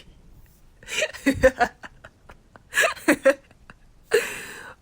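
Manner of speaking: long, loud, high fit of laughter; uncontrolled laughter. Acted. Can't take his sentimental talk seriously, cracking up. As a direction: crack up completely, in loud bursts.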